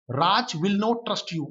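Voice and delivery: drawn-out word by word reading; slow dictation reading. Shown as a demonstration. Teacher reading the example to students, slowly. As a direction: spell it out slowly for the class. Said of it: "teacher reads the example sentence".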